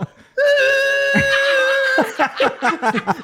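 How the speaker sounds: high, loud screeching brake noise, laughing through it. Retold or imitated. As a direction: mimic rusty brakes squealing at the bottom of the hill. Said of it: "It sounded like a train screeching".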